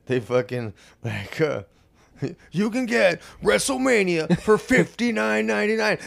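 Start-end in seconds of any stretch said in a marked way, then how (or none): 2.5-6.0 loud, high hype-man ad read; imitating a booming event promo; wrestling promo announcer. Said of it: "you know the John Cena music?".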